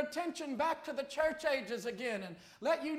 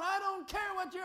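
mock nasty retort in a higher voice; retold nasty talk; 7 semitones higher than usual. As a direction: imitate her nasty talk, pitched up. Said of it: "he just talking like that".

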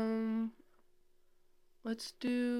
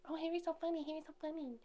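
high, breathy mock gushing; gushing fan voice; 8 semitones higher, 9 dB quieter, much faster than usual. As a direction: coo it like an adoring fan. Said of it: "people are like".

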